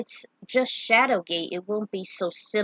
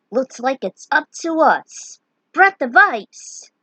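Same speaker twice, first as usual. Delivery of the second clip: loud high spell shout, slowing at the end; battle cry; 5 semitones higher, 8 dB louder, slower than usual. Acted. Hero casting ice at the mummy, shouting. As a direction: hurl the spell name out.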